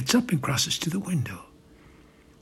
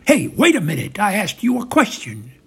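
loud, high, indignant call; sharp, loud demand; calling after someone; 6 semitones higher, 9 dB louder than usual. Acted. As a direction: stop him with a raised voice. Calls after man walking away.

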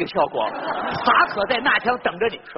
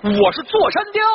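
bandit chief; 7 semitones higher than usual. Acted.

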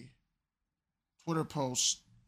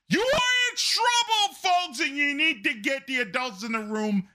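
high, loud warning cry; alarmed shout; 13 semitones higher, 8 dB louder than usual. Real, not acted. Warning the audience, rising alarm.

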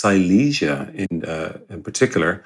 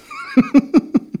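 high laugh. Real, not acted. Laughing at own joke.

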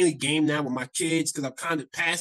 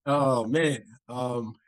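ordinary talk

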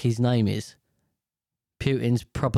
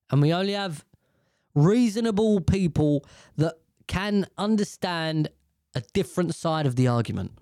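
ordinary talk, nothing marked

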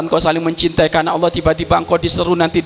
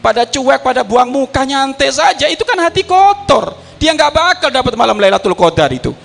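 fiery preaching; 8 semitones higher than usual. Acted. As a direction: scold the congregation from the pulpit. Preaching at full force.

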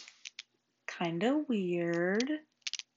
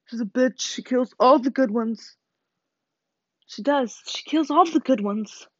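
angry; 4 semitones higher, 6 dB louder, slower than usual. Real, not acted. Angry rant about the author.

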